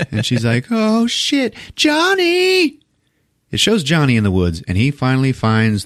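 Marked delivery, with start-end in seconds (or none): 0.7-2.8 very high imitated girlfriend cry; mock alarm. Pam realizes the danger and calls Johnny, mock alarmed. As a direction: call out in put-on panic. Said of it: "Pam is yelling to Johnny".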